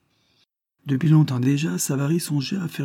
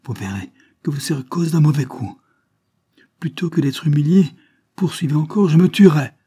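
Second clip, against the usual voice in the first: higher voice, building to a loud threat; exasperated outcry; 5 semitones higher, 6 dB louder than usual. A narrator's reading. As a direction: burst out at the end of patience. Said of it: "au comble de l'exaspération, je m'écrie". (cri exaspéré, de plus en plus fort)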